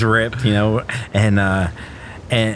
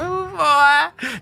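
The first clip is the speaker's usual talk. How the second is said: high on Demerol. Retold or imitated. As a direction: ham up being blissfully high. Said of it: "Just flying".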